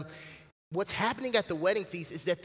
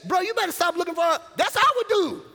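high, loud and fast protest; loyal friends protesting; 11 semitones higher, 7 dB louder than usual. Retold or imitated. Israel defending Samson to the Philistines, high, loud and fast. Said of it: "They didn't say".

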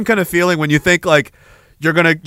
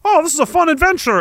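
naive cheery voice; 8 semitones higher than usual. Retold or imitated. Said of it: "it stops being like".

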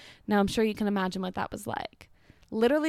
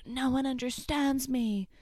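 mock angsty teen; 3 semitones higher, slower than usual. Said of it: "I already was like".

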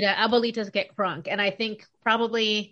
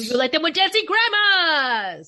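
monster truck announcer; 3 semitones higher, 5 dB louder than usual. Pretend. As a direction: hype it like a radio truck ad. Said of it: "who's got the best Monster Truck Rally announcer voice?".

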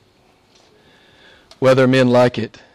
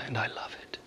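hushed whisper; 3 semitones lower, 19 dB quieter than usual. Real, not acted. Pastor confiding to the congregation, whispered. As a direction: drop to an intimate whisper.

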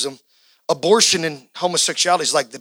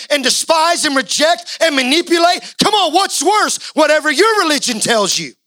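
higher, driving sermon voice with shouts; full-force preaching; 7 semitones higher than usual. Acted. Preacher rousing the congregation, high and fervent. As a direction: preach it hard and high to the rafters.

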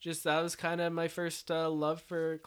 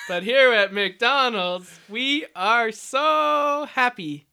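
mock ad slogan; 5 semitones higher, 10 dB louder, much slower than usual.

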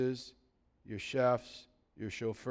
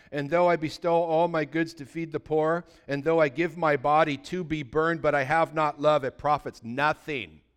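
louder, solemn scripture reading; 2 semitones higher, 7 dB louder than usual. Acted. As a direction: proclaim the verse with weight.

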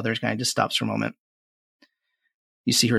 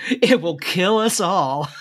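put-on higher, slow, gleeful young witch; 7 semitones higher, 4 dB louder, slower than usual. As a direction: relish the doom. Maiden, gleeful.

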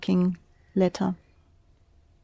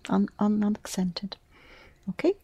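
normal talking voice

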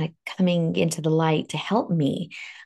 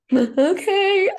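high, teary, breaking okay; choked-up okay through tears; a high sobbing okay; 4 dB louder than usual. Retold or imitated. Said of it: "I'm, like, sobbing".

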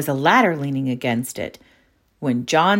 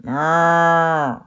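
cow moo; 4 dB louder than usual. A narrator's reading. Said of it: "said something down below".

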